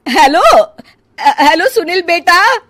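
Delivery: loud, high anxious calling. Acted. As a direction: call into the dead phone. Call cut, mother calls out.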